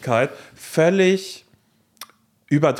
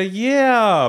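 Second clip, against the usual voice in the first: mock excited yeah; 9 semitones higher, 4 dB louder than usual. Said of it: "Und dann sagt er".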